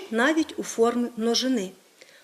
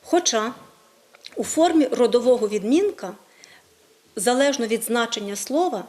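normal talking voice

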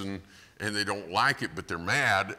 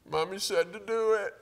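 put-on little child; 4 dB louder than usual. Father quizzes small child.

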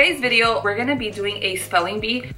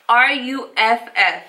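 spelling it out; slower than usual. Shown as a demonstration. Spelling the word out.

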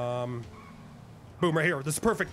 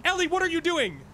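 exasperated calling; 6 semitones higher than usual. Real, not acted. Calling out to wandering teammate.

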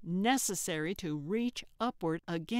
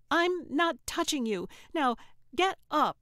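high, louder, strained through clenched teeth; through gritted teeth; 7 semitones higher, 4 dB louder than usual. Acted. Trixie refusing to help Gertrude, through gritted teeth. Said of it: "she said through gritted teeth".

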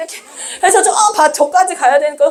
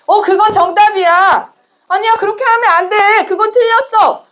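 raised pitch, as if judging; acted verdict; 6 semitones higher than usual. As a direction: mimic children declaring right and wrong. Acting out children's verdicts. (높은 목소리로 판정하는 아이들 흉내)

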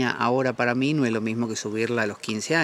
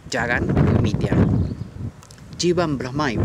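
recited Sanskrit verse; 4 dB louder, slower than usual. Retold or imitated. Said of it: "por eso dice".

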